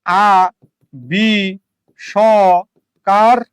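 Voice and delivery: "আ বি স কার" as a, bi, sho, kar with long gaps; each piece of "আ বি স কার" isolated, sho given its own vowel; four separate syllables. Shown as a demonstration. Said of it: "ব্যাপারটা কি এরকম".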